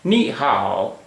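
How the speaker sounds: slow नी हाओ, each word drawn out; Mandarin tone demonstration. Shown as a demonstration. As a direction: say नी हाओ slowly with exaggerated tones. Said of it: "ऊपर से नीचे ही आवाज़ आ रही".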